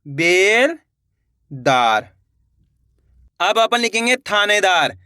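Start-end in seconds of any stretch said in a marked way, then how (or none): 0.0-2.1 बेलदार drawn out very slowly and low, syllables joined; slow teacher blending; slow blended word. Shown as a demonstration. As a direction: stretch बेलदार out as the letters combine. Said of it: "तो मिलके बन जाएगा".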